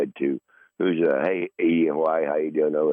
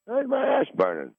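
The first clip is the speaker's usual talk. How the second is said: the same question jumps much higher; higher repeat; 14 semitones higher than usual. Retold or imitated. Waking at night, he asks again, much higher. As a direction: ask again, pitched way up in alarm.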